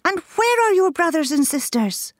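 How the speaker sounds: a worried mother shrieks her question; shrieking. A narrator's reading. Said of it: "shrieked Mummy Duck".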